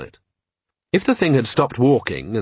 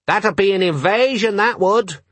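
put-on high-pitched little boy's voice; high, drawn-out child's voice; small boy; 9 semitones higher than usual. A narrator's reading. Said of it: "said a small boy holding a bucket".

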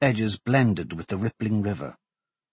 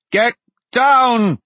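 loud urgent command; shouting; 12 semitones higher, 8 dB louder than usual. Acted. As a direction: bark the warning. Arrows fired at them.

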